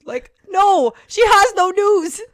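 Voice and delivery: high, loud incredulous outburst, slow; realising no news, exasperated outburst. Real, not acted. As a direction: scold yourself in disbelief. Exasperated at own foolishness.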